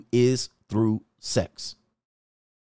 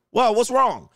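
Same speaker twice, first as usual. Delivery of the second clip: mock indignant wife; 8 semitones higher than usual. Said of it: "she'll suggest".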